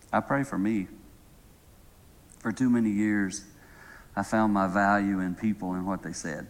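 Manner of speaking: low, soft, humble praying voice; prayer. Real, not acted. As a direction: pray quietly and earnestly, pausing between phrases. Leads a closing prayer.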